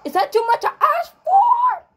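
shrieked plea, rising to a loud peak; exasperated high yell. Pretend. Gamer wanting peacefully to make the concert, exasperated shriek.

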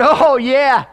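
loud, high, gleeful mock agreement; eager agreement. Son jumping to tell on dad, mock eager. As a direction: pounce on it, imitating an eager child.

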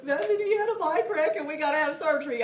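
high, loud imitation wail; hammed-up squalling to parents; mock bawling. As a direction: blubber it out in pretend hysterics. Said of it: "I'm bawling and squalling".

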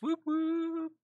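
high, sudden whoop-whoop like a siren; mock siren whoop. Real, not acted. Cheering twenty viewers with a high siren whoop. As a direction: celebrate with a piping siren call.

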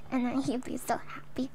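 whispered aside, barely voiced. Real, not acted. Telling chat his dog story, whispered. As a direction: drop to a hushed whisper at the story's end.

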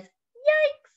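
very high, startled little bird squeak; squeaky bird yikes. Acted. Bird alarmed by the noisy bongos, very high squeak. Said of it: "And the bird says".

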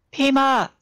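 loud, high-pitched call for help; shout. A narrator's reading. Trapped man tests the echo, shouting loud and high. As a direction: call out hard, falling at the end. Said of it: "he shouted".

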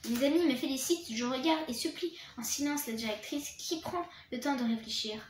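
usual speaking voice

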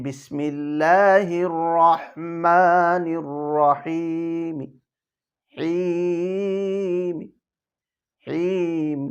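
slow recitation ending in a barely voiced mim; রহিম with the final মি only faintly sounded; rawm stop. Shown as a demonstration. Teacher demonstrating rawm to students, faint ending. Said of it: "সামান্য একটু মি উচ্চারণ করব".

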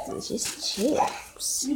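breathy slow whisper. Real, not acted. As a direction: hush it, slow and unvoiced. Whispered aside about chips.

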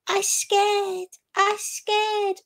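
put-on high, baby-ish little girl squeak; mock her cutesy scared act to the viewers; cute girly voice. Vlogger mocking Foodie's fake cute scared voice, squeaky and high. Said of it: "trying to be cute and girly".

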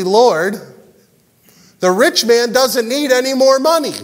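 lightly acted, high-pitched objection; mildly hammed-up complaint to the master; mock crowd protest.

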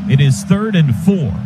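normal talking voice

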